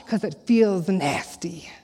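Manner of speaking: slow, soft whisper. Acted. Preacher confiding to the congregation in a slow whisper. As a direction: lean in and whisper it like a secret.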